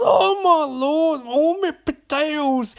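slow, high, hammed-up Irish wail; very Irish guy. Irish hotel man looks up from check-in, exaggerated Irish wail. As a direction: squeal it slowly in a mock Irish accent. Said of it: "there's this very Irish guy".

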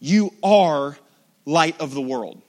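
loud stress on You in "You are"; emphatic. Shown as a demonstration. Preacher reading verse fourteen to the church, emphatic and loud. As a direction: punch You hard, then light of the world. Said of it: "Did you notice how emphatic it is".

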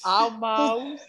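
imitating the baby's Southern word; Southern twang. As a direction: echo the toddler's Southern twang. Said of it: "he has a Southern accent when he learns words".

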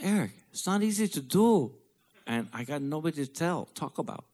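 high, fading plaint; father explaining it's not easy; lightly voiced father. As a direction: answer like the worn-out dad. Father's reply retold.

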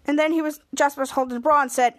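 normal talking voice

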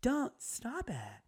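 feeble whispery protest, slipping into a tiny high voice; soft, toothless objection; half-hearted mock scolding. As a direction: imitate a limp, reluctant telling-off. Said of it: "It's kinda like that".

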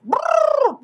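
high fluttering brrr; high Zoidberg trill. Retold or imitated. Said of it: "Zoidberg is like".

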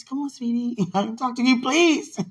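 high, loud begging through giggles; playful pleading. Real, not acted. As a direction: plead loudly, rising high on the last word.

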